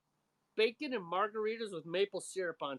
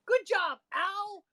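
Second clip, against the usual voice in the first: high, loud mock congratulations; sarcastic praise; 12 semitones higher, 8 dB louder, much slower than usual. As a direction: cheer him on with heavy sarcasm. Mocking Al Pacino's bad accent.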